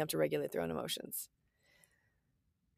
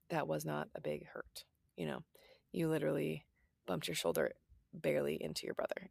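a sceptical aside, whispered under the breath; inner whisper; 3 semitones lower, 8 dB quieter than usual. Retold or imitated. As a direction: whisper the private doubt to yourself, as if the child must not hear. Skeptical private thought about kid's hurt.

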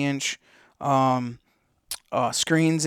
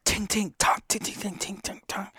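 whispered, softer tink tonk rattle; imitated tinking; 6 semitones higher, 8 dB quieter than usual. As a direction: mimic a small loose part knocking, under the breath. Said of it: "So I keep hearing it".

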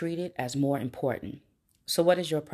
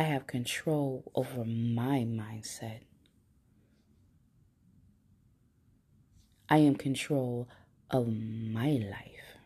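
guided affirmations; slower than usual. Acted. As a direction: recite slowly for listeners to repeat. Leads affirmations to repeat.

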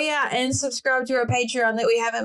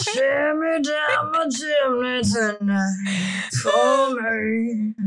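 smoker's voice; much slower than usual. Pretend. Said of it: "in your smoker's voice".

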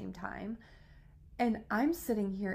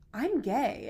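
louder, higher retold blurt; exclaimed confession; 4 semitones higher, 7 dB louder than usual. Telling confused friends in retold scene, exclaimed. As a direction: blurt it out in exasperation.

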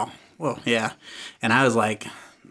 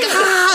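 retold scream. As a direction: let out a short imitation shriek. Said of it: "I start screaming".

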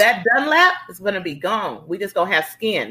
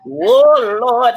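loud exasperated "oh Lord"; 4 semitones higher, 6 dB louder than usual. Real, not acted. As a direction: throw up your hands, exasperated. Exasperated aside about husband.

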